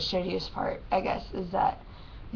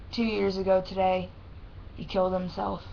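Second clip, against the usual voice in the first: ordinary delivery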